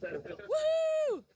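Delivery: high whooping call to get attention; hailing a taxi. Real, not acted. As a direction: whoop to call the crowd over. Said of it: "I need a whistler".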